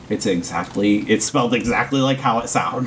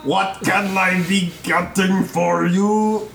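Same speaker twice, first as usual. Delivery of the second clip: slow, higher shopkeeper voice; 5 semitones higher, slower than usual. Acted. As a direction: welcome the customer in character. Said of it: "Granko looks up and goes".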